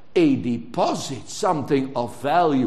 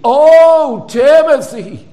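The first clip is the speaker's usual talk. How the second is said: "Oh, Timothy" cried out high and loud, long on Oh; an urgent, yearning call; emphatic Oh Timothy; 10 semitones higher, 13 dB louder, slower than usual. Shown as a demonstration. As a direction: plead the name with full force. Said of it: "he puts all emphasis on that name".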